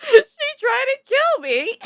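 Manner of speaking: high, loud and slow, laughing through it; laughing complaint. Retold or imitated. Telling mom about grandma, laughing high and loud. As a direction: squeal it out between laughs.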